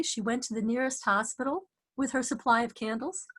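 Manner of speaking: ordinary talk, nothing marked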